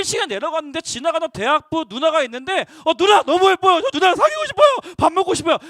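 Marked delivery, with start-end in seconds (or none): 2.8-5.6 loud, high, eager mock confession; hammed-up starstruck boy asking out a pretty girl; gushing boy. Boy gushing at a pretty college girl, loud and high. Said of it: "막 이렇게 하지 않는단 말이죠".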